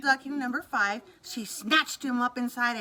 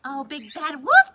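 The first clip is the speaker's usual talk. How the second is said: louder heartfelt call; crying out; 3 semitones higher, 9 dB louder than usual. Acted. Missus Duck calling to the wolf, glad cry. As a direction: call out to him gladly. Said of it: "cried Missus Duck".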